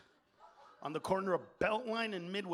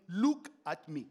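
put-on loud, commanding African accent; 3 semitones lower, 5 dB louder than usual. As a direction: order it in a mock African accent. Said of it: "like, African".

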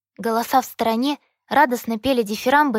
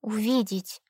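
shy quiet addition; 6 dB quieter, slower than usual. A narrator's reading. She adds the missing word shyly.

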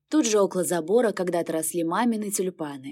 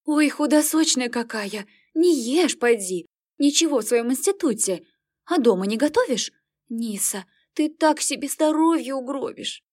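fussing mother; 5 semitones higher than usual. Acted. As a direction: cluck over her like a mother hen. Mother fusses over thin daughter.

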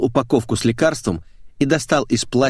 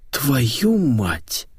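higher, drawn-out stunned oath; exhaled curse; 4 semitones higher than usual. Acted. Editor hearing the confession, exhaled curse. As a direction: let the curse out on a breath. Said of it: "выдохнул Митяев".

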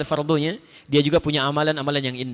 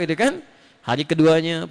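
normal talking voice

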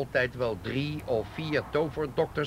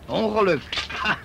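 high, loud mocking repeat of the word; sneering disbelief; scornful echo; 7 semitones higher, 6 dB louder than usual. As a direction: throw the word back in disbelief.

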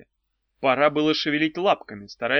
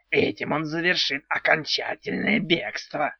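odd creaky little house spirit; goblin voice; 2 semitones higher than usual. Acted.

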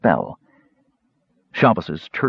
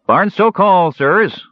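much higher and louder call; driver calling out; 11 semitones higher, 5 dB louder, slower than usual. Acted. Soldier driver announcing the stop to passengers, loud and high. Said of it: "said the soldier driver".

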